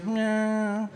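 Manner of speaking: high, stretched-out whine; odd senator noise. Retold or imitated. Senator reacting to being refused, weird high whine. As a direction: imitate him with a long strange "myeah".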